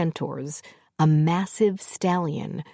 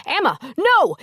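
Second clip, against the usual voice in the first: very high, loud yell; stopping his wife's attack; alarmed shout; 13 semitones higher, 5 dB louder than usual. A narrator's reading. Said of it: "Angus yelled".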